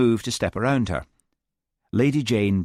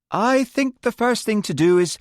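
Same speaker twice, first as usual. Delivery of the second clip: nervous smile; 12 semitones higher, 4 dB louder than usual. Acted. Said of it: "smiling nervously at the silent group".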